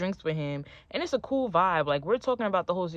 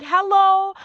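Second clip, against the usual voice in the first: high, loud mock-incredulous hello; indignant retort; 13 semitones higher, 12 dB louder than usual. Girlfriend answering his mom on the couch, indignant and loud. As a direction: fire back loudly, pitched way up.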